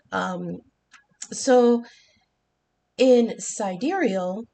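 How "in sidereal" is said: usual speaking voice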